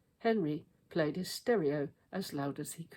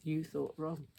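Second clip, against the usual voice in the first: usual speaking voice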